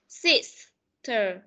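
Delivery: "sister" drawn out very slowly; slow model word. Shown as a demonstration. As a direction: stretch out "sister" so they can see the mouth. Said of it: "nhìn khẩu hình miệng của họ đọc này". (đọc "sister" thật chậm cho học sinh nhìn khẩu hình)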